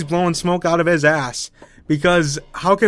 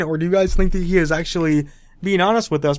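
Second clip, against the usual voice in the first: ordinary talk, nothing marked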